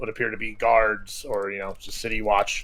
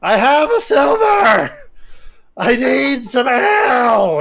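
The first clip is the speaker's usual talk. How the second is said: drawn-out, high, full-throated holler; yelling; 14 semitones higher, 9 dB louder, slower than usual. Acted. Homeless man being thrown out of a tavern, yelling. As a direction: bellow it down the street, stretching the words. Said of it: "still yelling".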